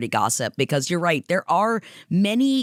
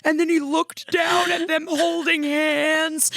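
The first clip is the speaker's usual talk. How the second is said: much higher, slow, swooning fan squeal; gushing fan; 11 semitones higher, slower than usual. Retold or imitated. Mocking fans gushing over the couple, high squeals. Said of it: "And everyone's like".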